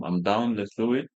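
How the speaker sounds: ordinary delivery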